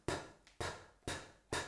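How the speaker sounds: P, P, P soft and relaxed, air only; soft unvoiced P pops; soft P sound. Shown as a demonstration. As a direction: breathe out each P gently. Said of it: "the P sound is a soft sound".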